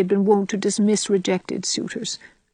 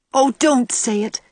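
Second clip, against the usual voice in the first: slow, higher pleading cry; 7 semitones higher than usual. A narrator's reading. Anne to Gilbert, begging him not to propose, pleading. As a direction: beg him not to say it, drawn out. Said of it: "cried Anne pleadingly".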